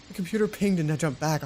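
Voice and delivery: ordinary talk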